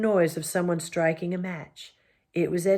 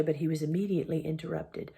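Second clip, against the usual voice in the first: ordinary delivery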